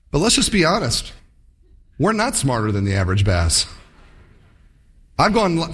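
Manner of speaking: ordinary talk, nothing marked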